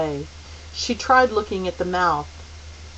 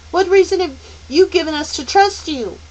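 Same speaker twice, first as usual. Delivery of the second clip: defiant challenge; 10 semitones higher, 8 dB louder than usual. A narrator's reading. Charles Wallace challenges.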